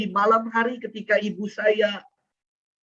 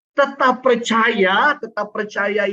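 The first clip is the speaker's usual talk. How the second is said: slow, loud, high call to keep faith; emphatic call; 4 semitones higher, 5 dB louder, slower than usual. Acted. The priest urges the congregation to keep faith, loud and slow. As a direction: stretch each word and lift it out. Preacher's key exhortation.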